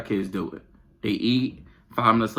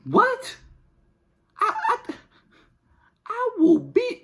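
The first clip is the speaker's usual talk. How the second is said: very high, stunned exclamation; outraged disbelief; slower than usual. Real, not acted. Mother reacting to the kids' claim, very high and stunned. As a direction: sputter in disbelief.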